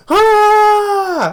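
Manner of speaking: long high yelling ah; a villain's wild war cry while shooting; mock scream. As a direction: let out a crazed held scream. He's screaming.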